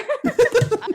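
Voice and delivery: short high laugh. Real, not acted. Player amused by liking the arm, laughing.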